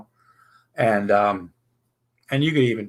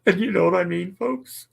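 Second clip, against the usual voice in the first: normal talking voice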